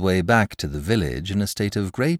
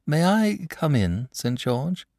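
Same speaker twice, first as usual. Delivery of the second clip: polite; 7 semitones higher, slower than usual. A narrator's reading. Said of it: "said the boy politely".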